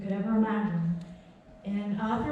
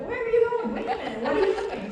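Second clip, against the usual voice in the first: squeaky high, giggling as she calls after him; high laughing call-out; 10 semitones higher than usual. Retold or imitated. [name] calling laughing after a fleeing guest. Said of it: "I'm like".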